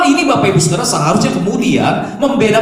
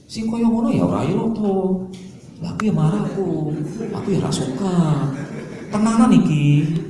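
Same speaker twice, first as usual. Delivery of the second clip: mild Central Javanese quarrel; 4 dB quieter than usual. Retold or imitated. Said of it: "Bertengkarnya gini".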